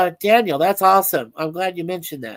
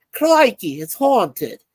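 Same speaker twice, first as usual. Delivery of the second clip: put-on Aussie accent; 3 dB louder, slower than usual. Joking to chat about the haunted rock, mock Aussie accent. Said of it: "Not my best Aussie accent".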